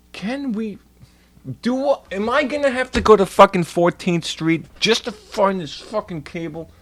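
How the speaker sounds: high, loud frustrated rant; exasperated outburst. Real, not acted. Fed up that the store lacks the cable, high loud rant. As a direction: let the annoyance burst out high and loud.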